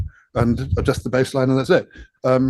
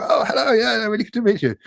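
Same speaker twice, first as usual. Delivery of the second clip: much higher, gushing and keen; overly eager greeting; 10 semitones higher than usual. Retold or imitated. To key people at parties, high eager gushing hello. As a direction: greet them like an eager fan. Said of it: "And I'd be going".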